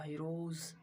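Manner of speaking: ordinary delivery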